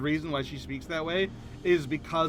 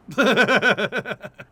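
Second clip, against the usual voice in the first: loud laugh; 5 semitones higher, 9 dB louder than usual. Real, not acted. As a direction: burst of loud, high giggling. Laughs after declining the accent.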